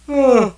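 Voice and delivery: hammed-up sigh out loud; loud high sigh. Real, not acted. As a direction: heave a big dramatic sigh. Reacting to the dogs.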